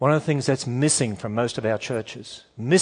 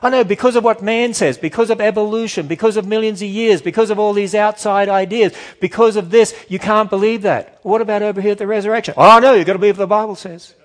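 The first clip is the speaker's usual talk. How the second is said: higher and louder, scoffing and exasperated; mocking church voice; 8 semitones higher, 7 dB louder than usual. Preacher imitating churches that doubt Genesis, mocking and loud. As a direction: play the compromising churchgoer, dismissive and loud. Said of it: "What do you hear?".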